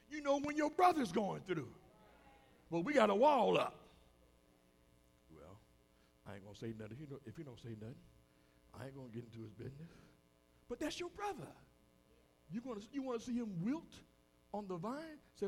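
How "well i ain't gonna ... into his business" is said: low, quick, soft aside; hands-off mutter. Acted. Onlooker avoiding his brother's business, low quick mutter. Acts a man declining to step in.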